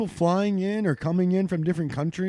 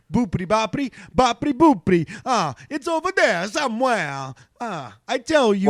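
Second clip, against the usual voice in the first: loud, higher, hammed-up Italian; mock Italian accent; 5 semitones higher, 3 dB louder than usual. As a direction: play up a cartoon Italian with sing-song swings. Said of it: "You gotta do your Italian accent".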